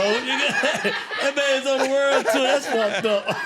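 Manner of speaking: slow, laughing through the words; teasing a friend, laughing. Real, not acted.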